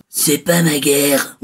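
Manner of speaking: Rambo voice. Retold or imitated. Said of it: "faire la voix de Rambo".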